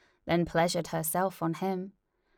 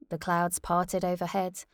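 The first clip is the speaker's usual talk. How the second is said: ordinary delivery, nothing marked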